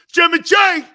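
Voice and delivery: loud, high, excited call-out of a name; loud greeting. Real, not acted. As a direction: greet him like a hype man. Greeting a caller loudly.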